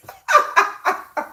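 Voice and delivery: airy giggle up high; real laugh at her own joke; high breathy laugh. Real, not acted. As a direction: burst into an unvoiced giggle. Laughing at herself.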